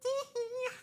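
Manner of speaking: very high, tiny anime giggle; shy anime girl giggle. Pretend. Streamer giggling as a shy anime girl. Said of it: "the shy anime girl".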